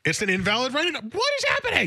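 incredulous, very high-pitched outburst; exasperated disbelief. Real, not acted. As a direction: voice shooting way up in disbelief. Write-in vote keeps getting rejected.

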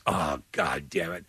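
low, slow, soft resigned grumble; weary cursing dad. Retold or imitated. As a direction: curse under the breath as a fed-up dad. Dad's reaction to news, acted.

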